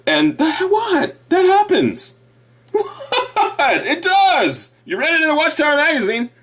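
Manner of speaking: high mock-indignant insistence; mock indignation. Defending the magazine in high mock indignation. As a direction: play it up, pitched high and defensive.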